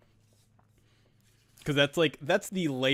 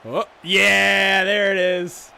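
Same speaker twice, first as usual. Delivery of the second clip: much louder, higher burst of delight; excited cheer; 6 semitones higher, 13 dB louder, much slower than usual. Real, not acted. Streamer cheering the big play on screen, loud and thrilled. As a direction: shout it out as the play hits.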